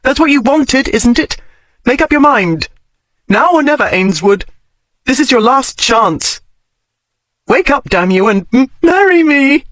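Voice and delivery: high, halting tearful plea; high and breaking, stumbling on marry; choking back a sob. A narrator's reading. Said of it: "she choked back a sob".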